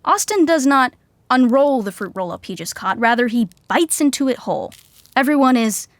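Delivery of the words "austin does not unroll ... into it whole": ordinary talk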